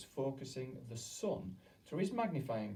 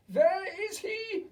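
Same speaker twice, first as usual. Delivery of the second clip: high, loud, slow witch snap; snapping; 11 dB louder, slower than usual. A narrator's reading. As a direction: snap it out searching.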